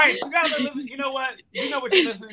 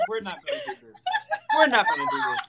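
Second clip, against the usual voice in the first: high, squeaky laughing speech; laughing through words; faster than usual. Real, not acted. Retelling her reaction to the joke, helplessly laughing. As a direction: let real laughter break up the line.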